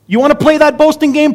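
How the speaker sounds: loud, high-pitched challenge; Paul. Acted. Paul taking on the boasters, defiant challenge.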